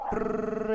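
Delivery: imitating a rattling noise; rolled r. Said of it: "ऐसा कुछ आ रहा है?".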